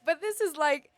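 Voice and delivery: real high, tearful waver; near tears. Real, not acted. Student about to cry over saying goodbye, tearful. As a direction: fight back tears mid-sentence. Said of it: "I'm gonna cry".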